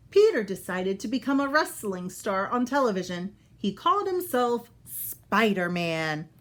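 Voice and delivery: bright, clear storybook read-aloud; reading to children. Acted. Teacher reading a Spider-Man book to kids, storybook voice. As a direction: read it out for young listeners.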